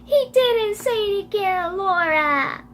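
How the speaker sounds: high, drawn-out protesting cry; acted high wail. Emily argues back at Crystal, high slow wail. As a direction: wail it slowly, pitch sliding down.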